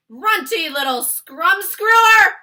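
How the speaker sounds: huge high bellow, slow; shouting. Acted. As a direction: roar the insult. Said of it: "he shouted".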